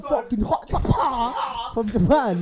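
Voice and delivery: high, loud, slow comic yell; joking shout. Acted. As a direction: blurt it out as a big joke. Shouted joke.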